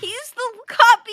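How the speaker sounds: high, loud accusation; mock outrage. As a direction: tattle loudly in a hammed-up protest. Mock outrage at being imitated.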